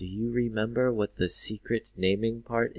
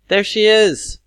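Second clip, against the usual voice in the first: very high, loud cry; exclaimed; 13 semitones higher, 13 dB louder than usual. Shown as a demonstration. Teacher repeats the example, fully exclaimed. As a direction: call it out in one excited burst. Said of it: "It's an exclamation".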